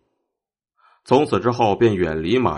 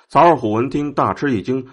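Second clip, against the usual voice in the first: ordinary delivery, nothing marked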